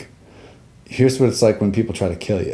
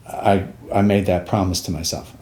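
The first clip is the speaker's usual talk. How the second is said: usual speaking voice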